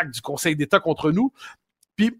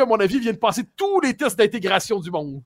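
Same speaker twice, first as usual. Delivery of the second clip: ordinary talk, nothing marked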